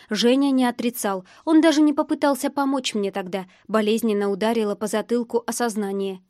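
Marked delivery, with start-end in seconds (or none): none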